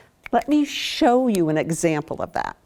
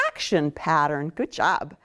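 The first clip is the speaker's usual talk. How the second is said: ordinary talk, nothing marked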